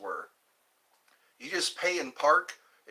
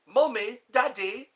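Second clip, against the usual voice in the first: higher, louder British put-on voice; British accent; 8 semitones higher, 5 dB louder than usual. Streamer echoing a viewer's question about kids calling parents, British accent. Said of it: "That sounds very British".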